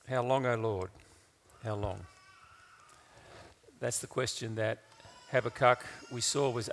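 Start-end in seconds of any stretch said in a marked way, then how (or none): 0.1-2.0 low, subdued plea to God; quiet lament. Acted. Preacher opening with the prophet's question, quiet lament. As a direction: let it sink softly, pausing before the repeat.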